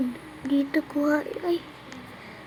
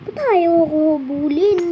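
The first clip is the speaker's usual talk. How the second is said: ordinary talk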